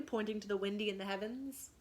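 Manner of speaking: ordinary delivery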